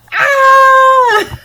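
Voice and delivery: long high scream of delight; piercing happy shriek; excited scream. Real, not acted. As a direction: scream at full volume, overjoyed. Screams over gift.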